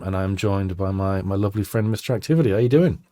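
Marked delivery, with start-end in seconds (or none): none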